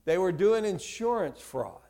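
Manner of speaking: ordinary delivery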